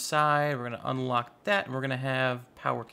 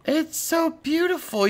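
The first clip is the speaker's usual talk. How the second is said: gasp, then much higher and louder in delight; awed gasp; 13 semitones higher, 6 dB louder than usual. Real, not acted. Admiring the hidden setup with a delighted gasp. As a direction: gush over it with a gasp.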